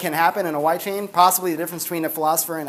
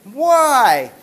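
put-on kid's whine; 4 semitones higher, 5 dB louder than usual. Daughter voiced, pestering.

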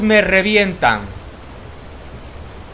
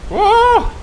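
surprised shout. Real, not acted. Sudden event in the game.

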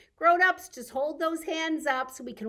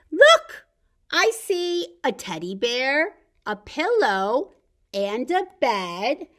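lively storybook read-aloud, slowing at the ends; bright read-aloud for toddlers; reading to children; 11 dB louder, slower than usual. Acted. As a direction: read the picture book to the little ones. Reads picture book to children.

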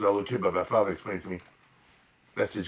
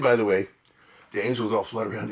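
usual speaking voice

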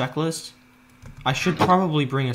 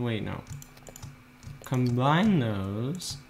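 ordinary talk, nothing marked

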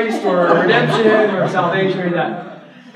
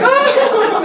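squealed, much higher oh; high mock surprise; 5 dB louder than usual. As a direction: yelp of cartoon surprise. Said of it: "And like".

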